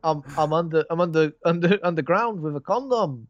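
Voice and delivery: a silly Northern bloke voice; playful put-on Northern twang; mock Northern accent. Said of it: "When I'm doing Northern".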